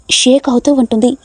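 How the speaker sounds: plain reading voice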